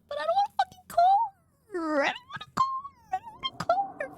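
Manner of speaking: high whimpering wail, fake sob; whiny fake crying. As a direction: whine it like a fake cry. Whining about calling.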